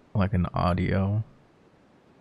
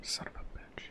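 whispered curse; 17 dB quieter than usual. Real, not acted. Nervous muttered curse alone.

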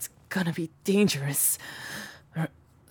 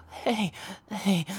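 normal talking voice

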